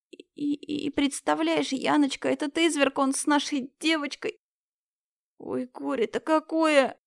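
put-on high, tearful old woman; grieving old woman. Old neighbour telling Yana the girl was found, high tearful lament.

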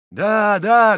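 loud, high eager cries; crowd's answer. A narrator's reading. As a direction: shout back to the leader. Mob answers its commander.

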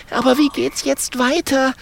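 high-pitched, desperate little character voice; desperate porcupine. Acted. Mathilda asks Holunder what to do now, desperate. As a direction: squeak the worried question up high. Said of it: "fragt Mathilda verzweifelt".

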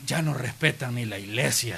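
low, soft aside to himself; disapproving grumble. Retold or imitated. Eli disapproving of the woman in church, low grumble. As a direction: grumble under your breath.

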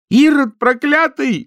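медленно, высоко и громко; выкрикни проклятие врагу; гневный крик. A narrator's reading. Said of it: "закричала она". (Нюра кричит на Гладышева в ярости)

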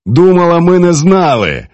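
gloating exclamation; triumphant. A narrator's reading. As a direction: crow it, having caught her out. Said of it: "з торжеством у голосі вигукнув".

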